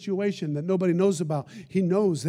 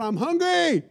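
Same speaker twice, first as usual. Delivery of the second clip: high pitched pretend cry out; mock scream; 10 semitones higher, slower than usual. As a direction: cry out in hunger, raised higher. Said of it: "screamed out".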